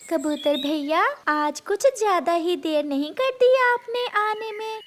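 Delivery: high chirpy sparrow teasing. Acted. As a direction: playfully scold him for being late. Sparrow teases late pigeon.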